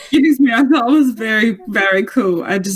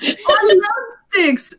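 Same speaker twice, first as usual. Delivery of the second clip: mock childlike; 10 semitones higher, much slower than usual. Repeats the joke, high and slow.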